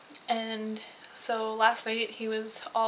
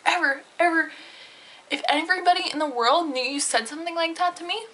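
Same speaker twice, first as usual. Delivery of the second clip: angry rant; 5 semitones higher, 5 dB louder than usual. Real, not acted. Vlogger raging at the guy's insult, loud and angry. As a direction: let the fury boil over at him. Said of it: "Oh my gosh, I was so angry".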